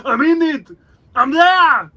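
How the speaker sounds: slow, laughing Austrian action-star voice; Arnold Schwarzenegger impression. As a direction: ham up the muscleman accent. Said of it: "Arnie did that introduction".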